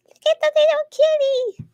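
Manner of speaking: high cutesy kitty talk. Real, not acted. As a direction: coo it to a tiny kitten. Cooing over crocheted kitty.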